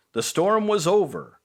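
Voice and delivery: ordinary delivery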